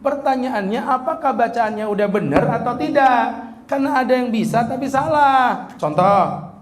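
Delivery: ordinary talk, nothing marked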